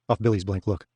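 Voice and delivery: ordinary delivery, nothing marked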